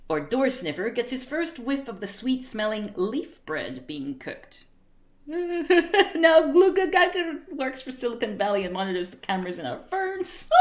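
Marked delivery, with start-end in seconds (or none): 5.3-10.6 high, loud start, settling as it goes; laughing. Real, not acted. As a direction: joke told through real laughter. Cracks up at own joke.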